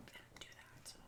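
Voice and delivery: whispered aside while texting. Real, not acted. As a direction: murmur it under your breath. Sorry, just gotta send a text.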